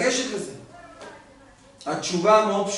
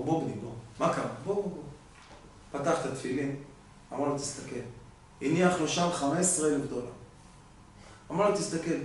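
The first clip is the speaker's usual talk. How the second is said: much softer, low fatherly voice; quiet understated father to son; hushed father; 5 semitones lower, 12 dB quieter, faster than usual. Retold or imitated. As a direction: speak softly, revealing the secret. Father gently shows the money.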